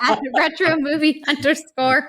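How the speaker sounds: garbled handle read while laughing; laughing through a mixed-up handle. Real, not acted. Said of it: "Excuse me".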